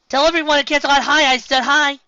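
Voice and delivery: high, loud happy farewell; calling out cheerfully. Acted. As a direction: call it out brightly. Twilight's voice calling goodbye.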